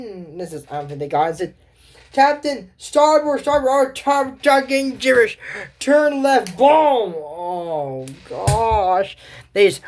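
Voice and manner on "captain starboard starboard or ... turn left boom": high, loud starboard calls; panicked steering shouts. Acted. Crew shouting to the captain before the crash, high and loud. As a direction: call the turn urgently.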